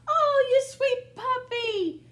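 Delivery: high, slow tender coo. Acted. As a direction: coo over the puppy. Cooing at the dog.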